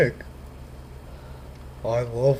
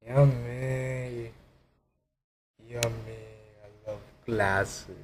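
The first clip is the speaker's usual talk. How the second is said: quiet; 8 dB quieter, slower than usual. Acted. Licking glasses, fading hush.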